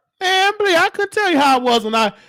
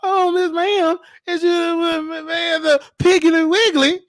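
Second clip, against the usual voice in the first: high, halting mock chauffeur; old film driver; 5 semitones higher, slower than usual. Driver talking to his passenger, high mock-servile voice. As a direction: imitate the driver fretting, pitched up. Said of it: "talking like the driver".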